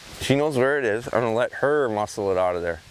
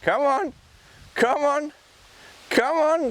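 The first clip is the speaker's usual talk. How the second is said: much higher, bright coaxing call; encouraging call; slower than usual. Real, not acted. Trainer urging his dog on, high coaxing. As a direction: coax the dog to keep coming.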